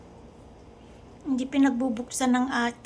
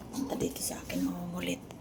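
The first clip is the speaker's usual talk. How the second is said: lower, softer whispered coaxing; 11 dB quieter, faster than usual. Real, not acted. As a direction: whisper to the cat up close. Softly calling cat to her.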